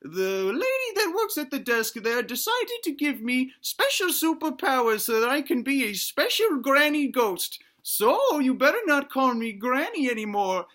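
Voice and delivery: put-on high-pitched old granny voice; granny ghost. Granny ghost explaining her superpowers to family, high granny voice. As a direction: play a proud granny in falsetto, drawing out the final name. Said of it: "and she says".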